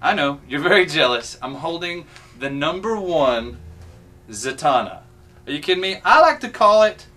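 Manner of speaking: ordinary delivery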